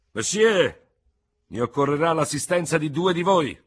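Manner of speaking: high, loud summons to the room; commanding call. Acted. General summoning two veterans as seconds, loud and high. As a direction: call for volunteers like an officer.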